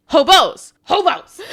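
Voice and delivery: high, loud, insistent correction; emphatic correction. Retold or imitated. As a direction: call it out to fix the blunder. Retold frantic correction to team.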